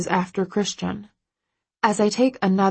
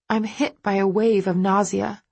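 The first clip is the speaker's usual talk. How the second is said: plain reading voice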